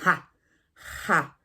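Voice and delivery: "Ja" with a rough throat sound; throaty ja. Shown as a demonstration. Spanish teacher showing the J: "Ja" rasped in the throat. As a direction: scrape the J in "Ja" from the throat. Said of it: "es sonido de la garganta".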